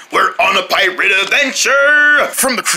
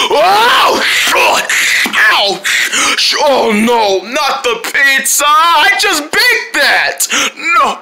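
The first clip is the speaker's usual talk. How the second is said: distressed shouting; 5 semitones higher, much slower than usual. Acted. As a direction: wail over the ruined pizza. Pizza ruined by sneeze.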